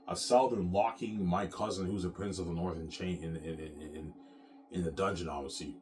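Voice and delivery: ordinary talk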